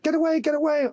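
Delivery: high-pitched mock alarm; put-on high voice, sending someone away; imitating panicked daughter. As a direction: do a child's high, urgent shooing. Daughter shooing window cleaner, retold.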